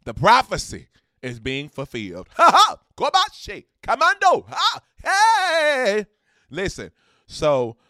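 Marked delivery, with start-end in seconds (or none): 2.4-6.1 loud, high whooping yells; joyful hype shouting; celebratory shouts. Real, not acted. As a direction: whoop it up like a wrestling fan. Celebratory shouts after the announcement.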